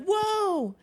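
squeaky high whoa; high mock whoa. Girls seeing a bloodied man, squeaky high whoa. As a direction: gasp it out amazed. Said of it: "they're just like".

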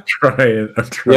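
laughing too hard to talk; tickled. Real, not acted. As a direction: squeeze the words out through giggles. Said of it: "He's getting all tickled".

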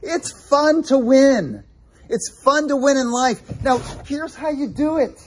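a hint of an earnest father urging his son; God as loving father. Preacher voicing God speaking to his son, earnest. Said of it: "He's like".

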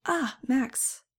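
high, lilting sing-song. Acted. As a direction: let it float up and down like a tune. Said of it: "she said in a pretty sing-song voice".

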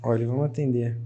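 ordinary talk, nothing marked

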